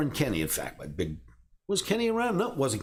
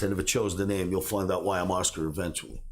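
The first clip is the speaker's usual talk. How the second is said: ordinary talk, nothing marked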